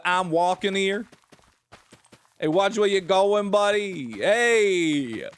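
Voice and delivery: hammed-up New York street accent, last words stretched. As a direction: call it out like an indignant New Yorker. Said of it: "Can't understand the New York accent?".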